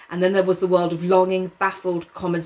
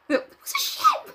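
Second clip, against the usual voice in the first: high outburst. Acted. Ralph shouting about ship.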